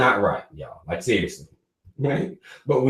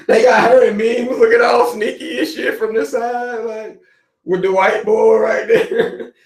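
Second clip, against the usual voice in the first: laughing; 9 semitones higher than usual. Real, not acted. Laughing about [name] memes.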